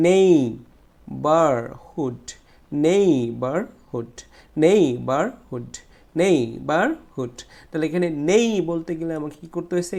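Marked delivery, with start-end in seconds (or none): 0.1-7.4 neighbourhood very slowly, loud long "nei"; stressed first syllable. Shown as a demonstration. Teacher drilling students, loud drawn-out "nei" in neighbourhood. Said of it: "এই নেইটাকে আমরা জোরে উচ্চারণ করবো".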